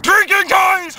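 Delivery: high, loud whoop; dad yelling. Acted. Dad announces drinking time to his son, yelling.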